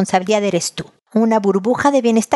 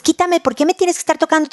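mock irritated snap; 8 semitones higher, faster than usual. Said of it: "no les dices".

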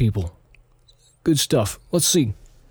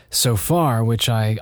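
normal talking voice

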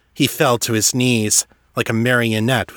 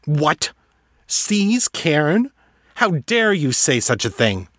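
sharp indignant outrage, pitched higher; How dare you, higher and sharp; angry outburst; 6 semitones higher than usual. Acted. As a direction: flare up at the insult. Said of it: "eyes flashing with anger".

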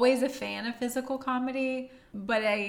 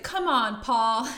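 retold yell; 3 semitones higher, 8 dB louder, slower than usual. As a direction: call out in playful exasperation, laughing at the end. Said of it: "I think I even yelled".

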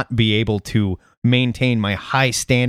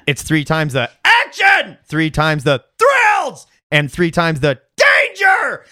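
yelling the caps; 4 semitones higher, slower than usual. Shown as a demonstration. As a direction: yell "three times the action" like all caps. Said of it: "we gotta yell the stuff that's in, uh, in caps".

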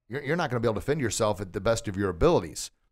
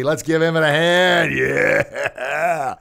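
loud, excited applause call; raised, celebratory cheer; 4 semitones higher, 7 dB louder, much slower than usual. Real, not acted. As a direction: whoop up the crowd for him. Cheering the child's successful demo.

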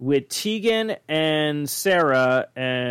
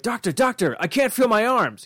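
higher voice of a worried patient; lightly voiced patient; 8 semitones higher than usual. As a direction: play the patient begging the doctor. Retelling cartoon joke voices.

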